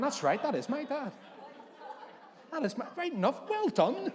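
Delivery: high, loud, exasperated praise; mock sarcastic congratulation. Retelling his exasperated sarcastic answer.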